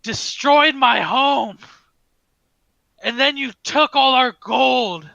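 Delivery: high, slow, anguished shouting; loud, drawn-out fury at a bitter loss; screaming with rage. Acted. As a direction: hurl each accusation at full volume. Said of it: "he's screaming at the top of his lungs".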